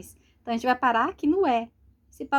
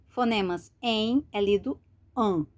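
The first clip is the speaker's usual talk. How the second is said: ordinary delivery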